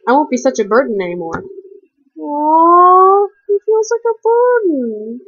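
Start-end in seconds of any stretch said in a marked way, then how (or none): 2.2-5.2 high, slow cooing aww; mock pity. Real, not acted. Reader teasing the character who feels a burden, slow mock coo. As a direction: coo over him teasingly.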